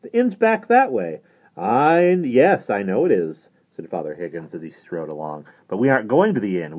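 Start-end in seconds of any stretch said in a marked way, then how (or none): 0.0-1.2 put-on very high, loud boyish voice; urgent, pitched-up protest; exclaiming boy. A narrator's reading. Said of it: "exclaimed Johnny, running after him". 1.6-3.5 loud, high start, drawn out; raised, theatrical reply; animated priest character. Acted. Priest answers the boy's protest.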